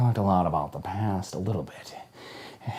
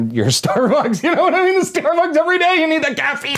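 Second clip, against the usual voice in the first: manic caffeine hype; 7 dB louder than usual. Acted. Acting out his morning coffee craving, manic and laughing.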